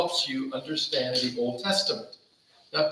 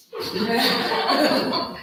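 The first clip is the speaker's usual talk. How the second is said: breathy laugh; 6 semitones higher than usual. Real, not acted.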